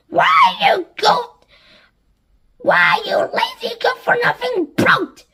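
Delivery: high, loud furious yell; shouting. A narrator's reading. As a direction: scream insults at him. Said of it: "as Spiker shouted".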